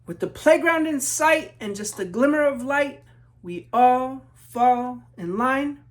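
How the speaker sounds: lilting rhyming read-aloud; story narration. A narrator's reading. Author reading to children, storybook narration. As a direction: read it like a picture book to kids.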